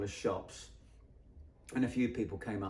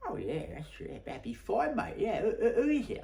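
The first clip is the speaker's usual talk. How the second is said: high, excitable mock reply; eager agreement; 10 semitones higher than usual. Stranger agreeing to join a musician, eager and high. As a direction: act out a keen yes, rising in pitch. Acted keen reply to the request.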